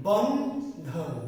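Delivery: "বন্ধন" very slowly as two syllables, বন্, then ধন; split into syllables. Shown as a demonstration. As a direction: break "Bandhan" after বন্ with a clear pause. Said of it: "কিন্তু অক্ষর দুইটি। কি কি?".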